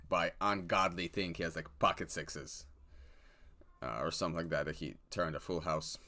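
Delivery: ordinary talk, nothing marked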